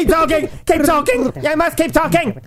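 high, loud urgent yell; frantic shout. Acted. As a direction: shout it like a panicked order. Urging shout at typist.

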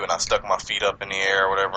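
ordinary delivery

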